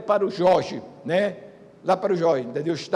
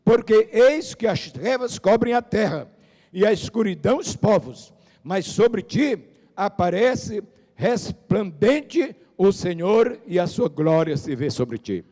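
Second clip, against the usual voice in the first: slow, lifted scripture reading; 3 semitones higher, 6 dB louder, slower than usual. Acted. Pastor reading Isaiah to the church, slow and solemn. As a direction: stretch each word of the verse.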